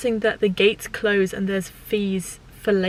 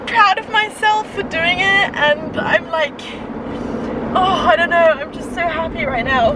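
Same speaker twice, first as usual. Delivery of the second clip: high, choked up but happy; proud, a little overwhelmed; emotional and teary; 5 semitones higher than usual. Real, not acted. Emotional farewell to au pair time.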